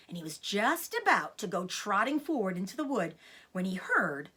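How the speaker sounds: ordinary delivery, nothing marked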